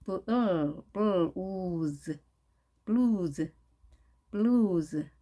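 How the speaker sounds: "블루즈" as English blues, long oo, voiced z; English pronunciation demo. Shown as a demonstration. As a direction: sound out "블루즈" slowly, holding the L into a long oo. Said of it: "영어는 그렇게 하지 않고".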